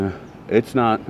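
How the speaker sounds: ordinary delivery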